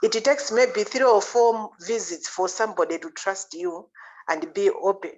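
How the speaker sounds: ordinary delivery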